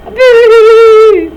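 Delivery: a big high shout of boo, like a ghost; loud ghostly boo. Acted. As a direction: jump out and yell it to startle. Family jumps out to scare.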